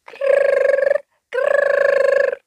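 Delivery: high buzzing ring sound; phone ring imitation. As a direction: imitate an old telephone ringing. Phone ringing.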